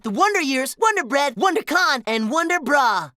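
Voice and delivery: ordinary delivery, nothing marked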